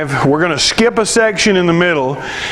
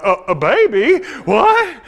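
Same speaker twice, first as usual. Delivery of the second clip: bewildered; 5 semitones higher than usual. Retold or imitated. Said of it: "And I'm like".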